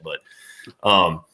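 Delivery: ordinary delivery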